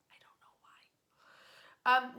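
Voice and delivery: slow, breathy whisper of puzzlement; whispered aside. Real, not acted. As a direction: drop to a secret whisper, baffled. Puzzled aside about carved pigeons.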